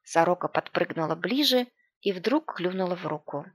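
ordinary delivery, nothing marked

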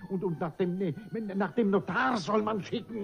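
high, loud stammering joy; flustered excitement. Acted. Jourdain stammering with joy about his daughter's wedding. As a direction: trip over the words in delight. Overjoyed at the news.

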